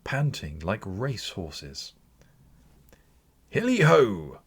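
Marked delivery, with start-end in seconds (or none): 3.5-4.4 loud, high whoop; a jolly old master whooping in delight; Fezziwig's hearty cry. A narrator's reading. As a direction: let out a merry hunting call. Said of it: "cried old Fezziwig".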